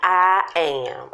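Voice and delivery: ordinary delivery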